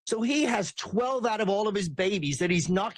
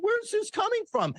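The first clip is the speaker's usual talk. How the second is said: much higher, falsely puzzled question; mock bafflement; 13 semitones higher than usual. Mocking people who act surprised by Elon, high feigned shock. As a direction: play it innocent and shocked.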